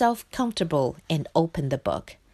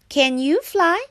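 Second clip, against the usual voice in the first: high, louder frog voice; frog character; 8 semitones higher, 5 dB louder than usual. A narrator's reading. Frog's voice in story.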